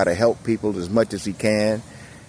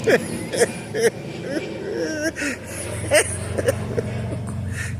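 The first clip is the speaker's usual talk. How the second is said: wheezing giggle fit; high breathless laugh; 6 dB quieter than usual. Real, not acted. Laughing at own joke.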